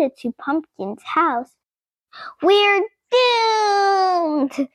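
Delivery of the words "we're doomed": high, despairing squeal; high doomed squeal of a little pet. A narrator's reading. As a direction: squeal in dread, a frightened guinea pig. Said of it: "squealed Rosie".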